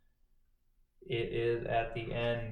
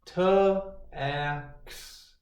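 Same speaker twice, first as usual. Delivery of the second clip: ordinary talk, nothing marked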